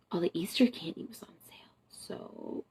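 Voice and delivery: whispered, sheepish admission; confessional whisper. Real, not acted. Admitting to viewers the candy was on sale, whispered. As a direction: confess it in a hush.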